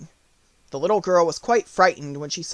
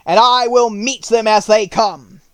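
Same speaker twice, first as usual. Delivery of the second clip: higher, loud, protective woodman; bold Tin Woodman; 5 semitones higher, 7 dB louder than usual. Acted. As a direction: call it out bravely to friends. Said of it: "said the Woodman".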